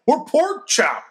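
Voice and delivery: high, loud holler. A narrator's reading. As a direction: holler it across the room. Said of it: "Stu hollers".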